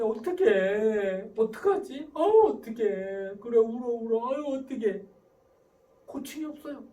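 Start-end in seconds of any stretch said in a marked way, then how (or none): 0.0-5.0 loud, slow, drawn-out wailing sympathy; overindulgent fretting. Acted. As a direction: fuss helplessly along with the child. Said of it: "내버려둬 스타일 어떻게 합니까?". (길게 늘이며 호들갑스럽게 달래듯)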